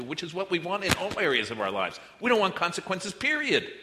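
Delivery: preaching at full force, climbing to a peak; rising preacher shout. Real, not acted.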